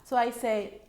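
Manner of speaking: ordinary talk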